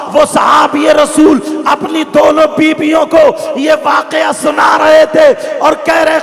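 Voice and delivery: high, shouted sermon on one sustained note; fervent, chanting preacher's shout; full-force preaching climax. Acted. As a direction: belt out each phrase to rouse the crowd.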